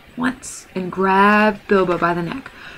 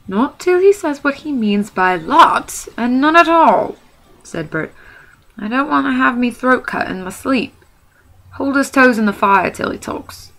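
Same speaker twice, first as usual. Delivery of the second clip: stubborn troll; 6 semitones higher, 4 dB louder than usual. Acted. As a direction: dig in and threaten cruelly. Bert refuses, threatening torture.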